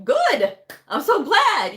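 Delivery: high and loud, beaming with praise; delighted cheer. Retold or imitated. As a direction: cheer them on like a proud mentor. Speaker cheers proud provider.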